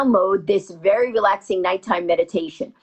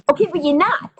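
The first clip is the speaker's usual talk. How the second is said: ordinary talk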